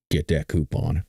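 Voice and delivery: low-pitched tagline impression; Outback commercial voice. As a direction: drop deep for the ad's sign-off. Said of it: "remember that Outback Steakhouse commercial".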